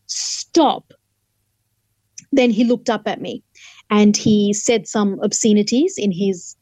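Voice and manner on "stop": higher, sharper STOP; second stop, raised in pitch, breathy and strained. Retold or imitated. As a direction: call out stop with more force. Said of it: "So I raised my voice again".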